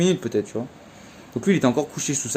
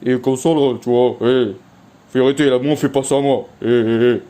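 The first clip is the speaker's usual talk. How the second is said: louder, stern warning to a dog; firm scolding voice; 3 dB louder than usual. Retold or imitated. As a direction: scold the dog firmly without yelling. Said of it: "j'ai continué de faire cette voix-là".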